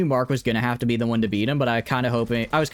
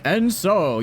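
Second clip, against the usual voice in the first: dramatic narrator opener, higher and louder; deep announcer voice; 8 semitones higher, 3 dB louder than usual. Shown as a demonstration. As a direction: boom it out like a fight narrator. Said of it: "the, like, deep announcer type voice to do it".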